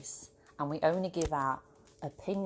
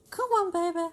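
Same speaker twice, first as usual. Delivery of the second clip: high, louder sing-song call to a pet; calling the dog; 14 semitones higher, 7 dB louder than usual. Real, not acted. Owner calling her dog Thea over, high and louder.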